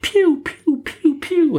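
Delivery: squeaky high piu piu blaster noises; high piu shots. Acted. Bamse shooting water at the skeletons, squeaky piu sounds. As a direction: fire off tiny high zaps.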